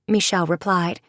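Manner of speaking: usual speaking voice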